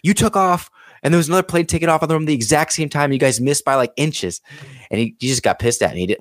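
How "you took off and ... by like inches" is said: high-pitched, urgent retelling; agitated insistence. Retold or imitated. Witness telling the pilot about the near collision, high and agitated. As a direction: spell out the near miss in disbelief.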